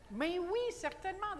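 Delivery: high, loud, emphatic French exclamation; energetic French. Shown as a demonstration. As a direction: exclaim it brightly in French, pitched right up. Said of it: "It's an energetic response to life".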